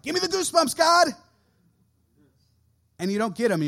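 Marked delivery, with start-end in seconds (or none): 0.0-1.1 much higher, louder hammed-up plea; mock pleading shout. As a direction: belt it at God like a worship fan. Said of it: "you're like".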